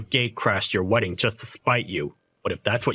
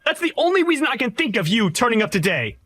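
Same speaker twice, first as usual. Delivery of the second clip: furious shout; 9 semitones higher than usual. Acted. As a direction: scream him out of your wedding. Groom screaming him out of wedding.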